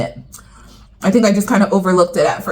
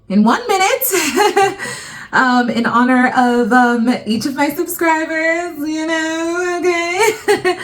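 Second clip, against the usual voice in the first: high, laughing nervous stalling; nervous laughter; 8 semitones higher, slower than usual. Real, not acted. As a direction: giggle through the nerves. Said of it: "I'm really nervous and I'm stalling".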